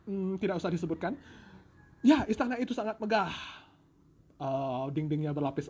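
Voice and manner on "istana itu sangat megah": usual speaking voice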